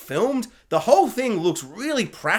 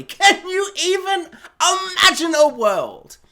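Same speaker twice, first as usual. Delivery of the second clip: high, loud, slow plea; overblown, high-pitched dramatic question; hammed-up amazement; 12 semitones higher, 5 dB louder than usual. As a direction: stretch it out in mock wonder. Hammed-up plea in quoted speech.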